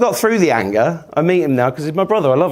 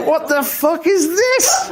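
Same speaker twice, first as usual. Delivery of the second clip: bewildered; 14 semitones higher, slower than usual. Retold or imitated. Bewildered 2020 reaction.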